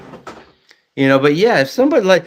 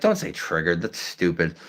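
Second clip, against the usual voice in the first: flat retort; 7 semitones lower, 8 dB quieter than usual. Retold or imitated. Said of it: "I was just like".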